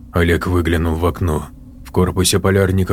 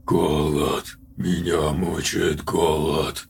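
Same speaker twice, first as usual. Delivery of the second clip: slow, hoarse and much softer; 3 semitones lower, 8 dB quieter, much slower than usual. Acted. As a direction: rasp it out low and slow like a starving monster. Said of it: "мужской, но очень хриплый".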